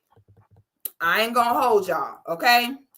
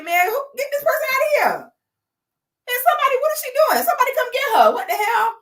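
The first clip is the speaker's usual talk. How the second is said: mock alarmed stranger; 11 semitones higher, faster than usual. Acts out expected reaction.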